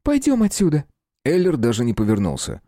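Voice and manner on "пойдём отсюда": put-on very high pleading girl; high-pitched worried appeal; anxious plea. A narrator's reading. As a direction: urge them away, pitched high. Girl pleads to leave fight.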